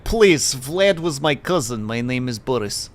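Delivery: dismissive mock Russian accent, falling away. Boris explaining Vlad was the cousin, Russian accent. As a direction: brush it off in a bad Russian accent. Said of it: "I should have gone with".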